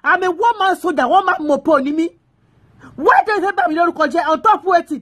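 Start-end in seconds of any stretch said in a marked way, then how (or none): none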